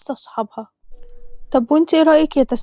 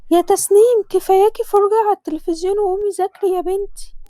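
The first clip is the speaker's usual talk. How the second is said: mother calling; 7 semitones higher than usual. Acted. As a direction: call her daughter from across the flat. Mother calls daughter to study.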